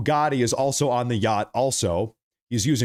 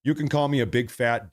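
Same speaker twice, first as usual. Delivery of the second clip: normal talking voice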